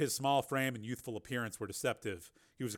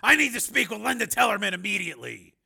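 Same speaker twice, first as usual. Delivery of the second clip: raised, louder, insistent voice; urgent demand; 8 semitones higher, 10 dB louder than usual. A narrator's reading. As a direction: demand to be put through. Steele demands to be connected.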